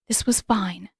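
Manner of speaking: whispered, unvoiced, telling herself it's fine; breathless whisper. A narrator's reading. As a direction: breathe the words out to calm yourself. Panicking heroine reassures herself.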